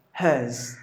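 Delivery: "hearse" with the R silent; British pronunciation. Shown as a demonstration. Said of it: "That's how you have to pronounce".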